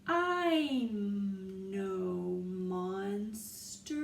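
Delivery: very slow drawn words; stretched out. Shown as a demonstration. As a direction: stretch each word out. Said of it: "taking such a long time to read".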